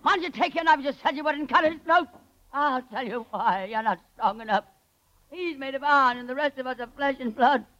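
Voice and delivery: high, strained voice on the edge of breaking; hysterical rant. Acted. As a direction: rave at Kovac, half mad with hunger. Starving Connie turns on Kovac.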